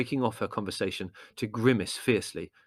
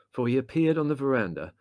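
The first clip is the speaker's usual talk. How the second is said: plain reading voice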